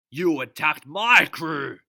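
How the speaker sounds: high, loud and slow; furious captain yelling; angry shout. Acted. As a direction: yell the charge.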